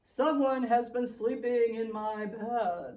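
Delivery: a big bear rumbling his complaint; big Papa Bear. Acted. Papa Bear loudly complains about his eaten porridge. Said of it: "and Papa Bear said".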